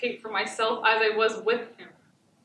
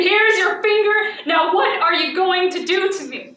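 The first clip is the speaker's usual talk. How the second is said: loud, high defiant scream; 8 semitones higher, 10 dB louder than usual. Retold or imitated. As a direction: hurl it at him, screaming. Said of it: "and I screamed".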